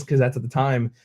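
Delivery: usual speaking voice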